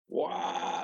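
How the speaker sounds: a soft, high, hollow wah; imitated hyrax call. Showing the interviewer how hyraxes start their call, soft high wah. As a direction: start the animal's call small and breathy. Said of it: "They start kind of low".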